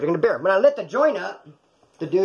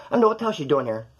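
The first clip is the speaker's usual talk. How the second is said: usual speaking voice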